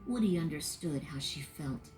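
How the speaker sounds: plain reading voice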